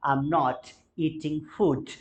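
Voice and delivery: spoken very slowly, word by word; slow model sentence. Shown as a demonstration. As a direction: lay out each word slowly for learners. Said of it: "मैं खाना नहीं खा रहा हूँ". (बहुत धीरे, शब्द-शब्द करके)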